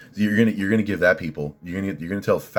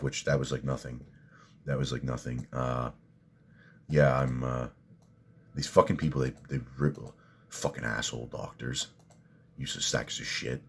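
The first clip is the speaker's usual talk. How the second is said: quiet muttering; 4 semitones lower, 7 dB quieter than usual. Real, not acted. Muttered angry asides.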